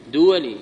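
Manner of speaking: دولي said "DU-wa-li", from duwal; the faulty "duwali" for دولي; plural-based pronunciation. Shown as a demonstration. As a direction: say دولي in three syllables, starting with short u.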